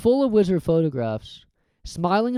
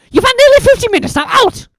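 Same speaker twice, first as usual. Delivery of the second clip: much higher, louder and fast; firm matron; 11 dB louder, faster than usual. Acted. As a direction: shoo them out like a strict nurse. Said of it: "she said firmly".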